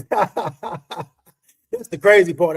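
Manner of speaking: slow, teary laugh through the words; slow laughing, choked up; laughing through emotion. Real, not acted. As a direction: laugh it off while moved. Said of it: "I don't never get emotional".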